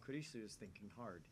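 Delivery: ordinary talk, nothing marked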